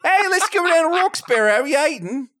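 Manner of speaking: high-pitched put-on Philly accent; mock Philly accent. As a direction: call out in a hammed-up Philly accent. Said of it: "she does a Philly accent".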